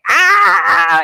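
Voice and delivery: high, loud mock wail; re-enacted "ahh" of an angry cry; imitated cry. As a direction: act out a cathartic crying yell. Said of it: "I had a good cry".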